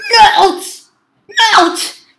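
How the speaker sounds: very loud, high repeated yell; desperate shouting. Real, not acted. Player failing the last try at the game, shouting. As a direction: yell it twice at full force.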